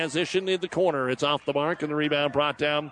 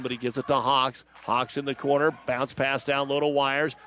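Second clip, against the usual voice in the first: ordinary talk, nothing marked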